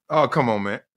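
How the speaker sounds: ordinary talk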